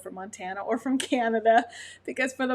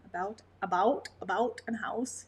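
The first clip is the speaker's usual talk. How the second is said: "about" said the Canadian way, like "aboot"; light Canadian "about and house"; Canadian accent. Retold or imitated. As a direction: imitate a Canadian hockey announcer saying "about". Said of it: "Canadian dialect by listening to your announcers".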